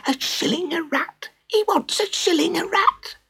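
gossiping crowd. Acted. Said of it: "swept through the crowd in whispers".

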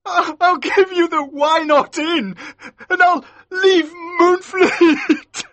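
loud, very high tearful plea; crying. A narrator's reading. Captive gives up everything to live, crying. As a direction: bargain desperately, breaking into wails. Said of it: "Maskew started crying like a baby".

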